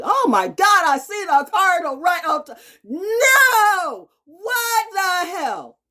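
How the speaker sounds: very high, loud screaming outburst; real shrieking amazement. Real, not acted. Speaker suddenly seeing a cardinal, shrieking amazement. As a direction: shriek in astonishment at the sight.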